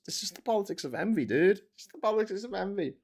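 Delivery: lightly mocking smug dismissal. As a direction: brush it off like a smug pundit. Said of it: "if I mention that".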